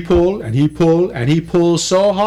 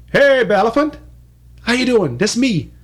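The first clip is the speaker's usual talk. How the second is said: cheery hailing; 7 semitones higher than usual. Acted. Barabi running up to greet the elephant, cheery high call. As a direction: hail him brightly from afar.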